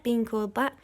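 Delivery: ordinary delivery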